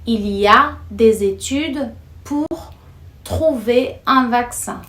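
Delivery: unhurried, clear French read-out; slow example sentence. Shown as a demonstration. Teacher reading an example sentence slowly to learners.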